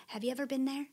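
ordinary talk, nothing marked

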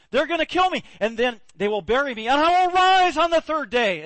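Jesus telling the twelve he'll be crucified; Jesus foretelling. Acted. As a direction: proclaim it dramatically. Jesus continuing his prophecy.

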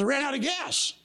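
high-pitched quoted comeback; retold retort. Retold argument over a car.

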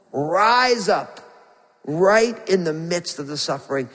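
usual speaking voice

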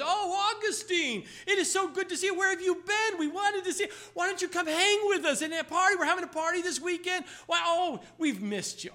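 high, bright-toned flirty woman's voice with a laugh; flirtatious woman. Acted. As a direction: coo his name like an old flame luring him back. Old flame greets Augustine warmly.

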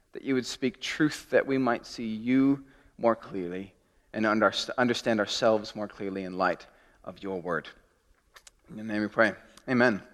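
hushed pastoral prayer. Acted. Leads a prayer.

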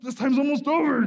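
a frantic high yell at his own frozen hand; screaming at himself. Retold or imitated. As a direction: shout yourself into courage. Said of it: "I'm screaming at myself".

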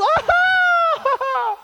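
long, loud mock scream; terrified shriek. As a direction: wail high in hammed-up panic. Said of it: "They're scared out of their mind".

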